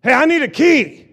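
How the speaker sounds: insistent holler for help; calling out. Acted. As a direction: call out as if to be heard by God. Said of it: "he's calling out to the Word".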